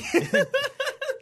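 high, loud laugh breaking in; burst of laughter. Real, not acted. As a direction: crack up mid-line. Laughing mid impression.

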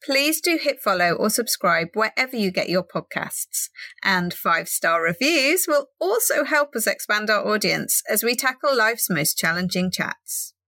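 podcast outro. Acted.

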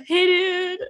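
very high attempt at a British greeting; mock British accent. Telling how English cops greet people, high mock British. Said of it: "In England, they go".

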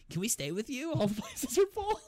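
pleading Cartman. Retold or imitated. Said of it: "Cartman says".

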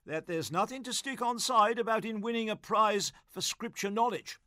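a hint of an over-cheery, tipsy prize-giver; chummy and a touch sloshed, lightly played; lightly voiced tipsy Gussie. Tipsy Gussie chats to a prize-winning boy, lightly voiced.

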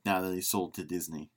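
normal talking voice

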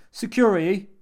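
loud, high cry for help; blurted call. A narrator's reading. Jimmy calls security on the phone, a high urgent shout. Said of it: "He jabbed at the dial and blurted".

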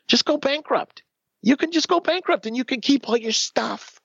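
high, breezy voice of the lawyer; a high airy imitation of the lawyer; mock attorney. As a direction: make the advice sound glib and easy. Mimicking the attorney's advice.

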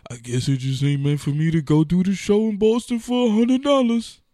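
hammed-up sulky sigh of giving up; mock resigned whine. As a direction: play the self-pitying quitter.

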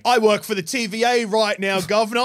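mock cockney accent; cockney British Loki. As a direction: play Loki as a hammed-up British geezer. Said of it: "I'm British, so this is how I fucking sound".

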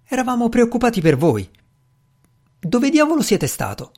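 voce acuta, preoccupata; rimprovero concitato. A narrator's reading. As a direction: rimprovera l'amico con affettuosa agitazione. Grant rimprovera l'amico appena tornato. (sgridata apprensiva)

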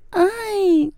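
high, whiny mock "Aj"; mocking imitation. The boyfriend in his car, mocking her cry in a whiny high voice. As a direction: mimic her cry to taunt her. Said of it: "typ hånar mig och härmar mig".

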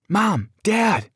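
urgent call through the house; panicked shout. A narrator's reading. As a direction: call out in alarm to your parents. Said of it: "I shouted".

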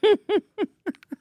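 high, light giggle; laughing to oneself. Real, not acted. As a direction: giggle away at your own silliness. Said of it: "I don't know why I'm laughing to myself".

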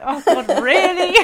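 high, loud mock-dismay breaking into laughter; exasperated reluctance. Mum replying to daughter's demand for accents, exasperated. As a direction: protest in exaggerated, laughing disbelief.

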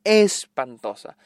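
"Espantosa" stretched slowly, syllable by syllable; slow emphatic repeat. Real, not acted. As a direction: drag out "Espantosa" to drive the verdict home. ("Espantosa" alargada y lenta, con énfasis)

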